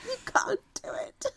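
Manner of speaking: very high, breathy teasing whisper. Pretend. Teasing the cohost, two contrasting takes.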